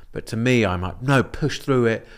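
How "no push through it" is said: acting out a dismissive push, raised and slow; insistent self-talk. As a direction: override your own doubts with higher, louder emphasis. Repeats acted push to oneself.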